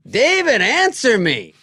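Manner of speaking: high, loud hollering into a phone; comic retold outburst at full volume; mock demanding yell. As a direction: holler it like a desperate caller, hammed up. Retold demanding late-night phone message.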